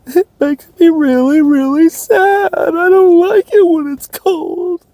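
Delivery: high, wailing mock sob, slowing into a whisper; fake crying. Vlogger fakes crying about seasonal depression for the camera, hammed-up sobbing. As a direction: ham up a tearful whine. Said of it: "Is that good acting?".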